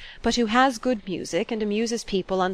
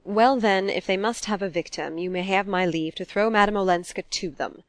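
plain reading voice

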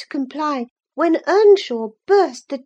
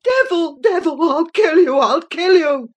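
powerless fury; 4 dB louder than usual. A narrator's reading. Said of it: "frantic, powerless fury".

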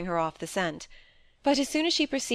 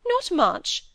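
high, louder, eager reply; 12 semitones higher, 5 dB louder than usual. A narrator's reading. Wife relieved to be helped in confessing, eager. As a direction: jump in eagerly, pitched high. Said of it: "she answered eagerly".

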